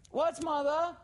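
high, loud shout to another room; calling upstairs. A narrator's reading. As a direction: call up to someone you can't hear. Laura calls upstairs to her mother.

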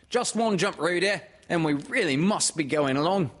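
high, loud mother character; hurrying call, pitched well up; Kanga. Acted. Mother hurrying her child along, high and loud. Kanga hurries Roo home.

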